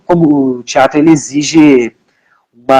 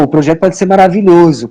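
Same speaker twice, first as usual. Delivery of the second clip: ordinary talk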